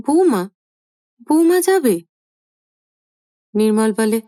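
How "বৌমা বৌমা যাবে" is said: high, louder astonished question; mother stunned the daughter-in-law goes too; shocked. A narrator's reading. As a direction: voice leaps up in disbelief.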